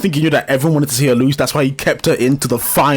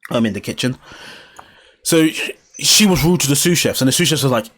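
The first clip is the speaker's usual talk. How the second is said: ordinary delivery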